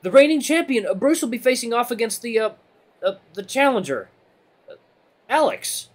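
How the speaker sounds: high, loud, faltering announcement; hesitant rabbit announcer. Acted. Timid rabbit naming champion and challenger, hesitant and high. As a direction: stall with uhs before the final name.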